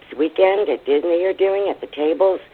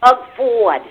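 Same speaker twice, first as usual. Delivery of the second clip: higher, louder mock name-call; imitating the co-host; 9 semitones higher, 7 dB louder than usual. Caller mimics how the host says a name, teasing imitation. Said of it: "I do not talk like that".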